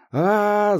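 loud high pained cry; man down shout. Acted. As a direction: cry out, shot in the leg. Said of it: "закричал раненый".

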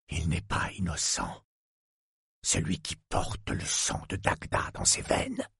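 low, soft sinister murmur; low villain whisper. A narrator's reading. The villain answers the young woman about the children, low sinister murmur.

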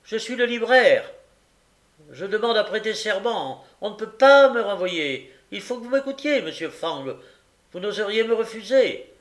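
high, loud, urgent plea to the magistrate; a flustered bookseller demanding to be heard; insistent bookseller. Acted. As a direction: bookseller insisting loudly on his right to testify.

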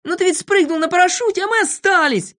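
high-pitched group protest; excited high justification; plaintive excuse. A narrator's reading. Friends make excuses to Знайка.

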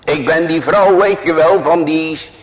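high, loud boastful woman; proud Abigail. Acted. Preacher voicing a proud Abigail speaking to David, high and loud. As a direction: hammer her proud self-importance. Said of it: "en ze zegt".

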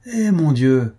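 drawn-out, lightly taunting; mocking calm. A narrator's reading. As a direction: feign sympathy with cool mockery. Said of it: "avec un calme railleur".

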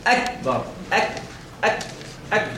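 "أكبر", then short "أك" with a faint k release; light hams. Shown as a demonstration. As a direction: drill "أك", letting a tiny breath escape after ك. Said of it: "عايزين همس خفيف".

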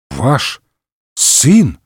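halting, incredulous question; astonished. A narrator's reading. D'Artagnan hears of Athos's son, amazed. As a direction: split the words with a stunned pause. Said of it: "ещё больше поразился гасконец".

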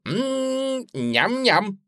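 high, savouring yum-yum; high-pitched contented munching; little girl munching. A narrator's reading. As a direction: hum with relish like a child eating candy. Suzy relishes the chocolate.

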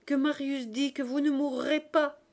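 high, loud, distressed insistence; tearful. A narrator's reading. Cosette insisting father will not die, tearfully loud. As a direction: plead urgently as if through tears. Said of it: "reprit Cosette tout en larmes".

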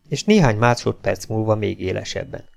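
ordinary delivery, nothing marked

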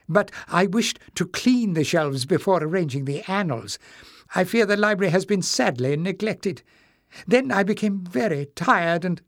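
put-on a flustered old librarian apologising; old librarian Joseph.

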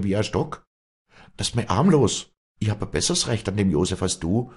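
high, loud, heated defiance; passionate protest. A narrator's reading. Afra refusing to be thrown out, passionate protest. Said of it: "rief Afra leidenschaftlich".

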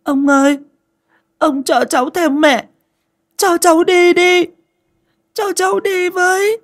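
put-on a haunted boy crying out, begging to go; wailing pitifully. A narrator's reading. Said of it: "ngày một to hơn, gào thảm thiết hơn".

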